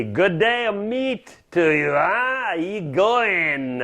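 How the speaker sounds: exaggerated Australian twang, high and drawn out; hammed-up Aussie greeting in a French accent; mock Aussie accent. Shown as a demonstration. French chef showing off his Aussie, exaggerated Australian accent. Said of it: "in good Aussie spirit, I say".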